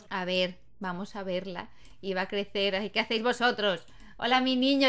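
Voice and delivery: ordinary talk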